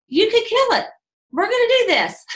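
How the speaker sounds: high, eager pep talk; excited. Retold or imitated. Pumping up new recruit.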